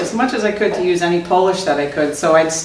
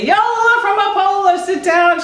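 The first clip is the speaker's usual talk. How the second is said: hearty greeting call; 12 semitones higher than usual. Retold or imitated. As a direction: sing out the name in a high, stretched greeting. Repeats greeting to wrong woman.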